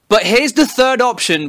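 normal talking voice

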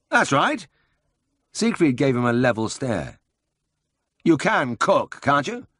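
high, lively voice for the bustling boss; brisk Siegfried. A narrator's reading. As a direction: play the eager older brother full of a plan.

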